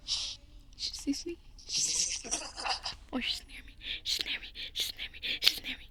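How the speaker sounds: soft breathless whisper; terrified whisper. Real, not acted. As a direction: whisper in hiding. Hiding from Granny nearby.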